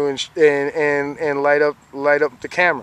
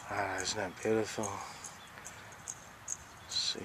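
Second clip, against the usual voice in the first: ordinary talk, nothing marked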